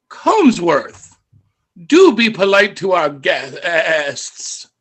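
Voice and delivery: loud, slow, prim goat scolding; talking goat. Acted. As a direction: draw the last word out into a wavering bleat. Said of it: "one of the goats in the pen turns and looks".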